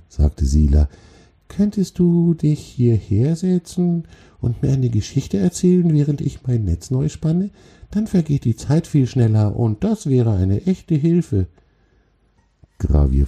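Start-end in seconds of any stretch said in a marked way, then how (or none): 1.5-11.5 high, tiny spider voice; friendly little spider. Acted. Spider asks the troll for stories while she spins, friendly. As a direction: make the request in a high creature voice. Spider figure speaks in character.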